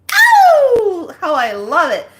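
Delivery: host thrilled, gushing to her audience; gushing delight. Real, not acted. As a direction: soar up on the oh, thrilled. Gushing greeting to viewers.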